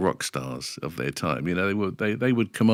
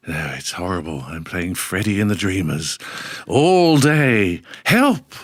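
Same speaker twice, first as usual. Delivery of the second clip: Kenny Everett; 3 dB louder, slower than usual. Retold or imitated. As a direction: impersonate a comic moaner, erupting at the end. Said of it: "And he would go".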